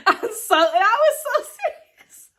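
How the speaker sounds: high, loud laughing insistence; laughing hard. Real, not acted. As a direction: insist through a fit of laughter. Laughing at her own story.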